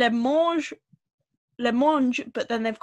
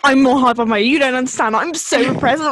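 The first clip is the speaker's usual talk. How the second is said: mock dramatic protest; 2 semitones higher, 7 dB louder than usual. Real, not acted. Joking self-pity, loud.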